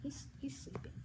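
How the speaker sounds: soft unvoiced whisper. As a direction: whisper so he stays asleep. Someone sleeping in play.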